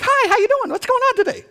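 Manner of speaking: high, bright-switch cheery phone greeting; sudden sweet phone voice. Retold or imitated. Mocking the family member's instant cheery voice on the phone. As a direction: flip to a sugary high hello. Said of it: "and they're like".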